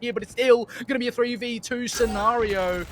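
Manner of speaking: fast, high play-by-play; esports caster. Acted. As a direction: rattle off the action.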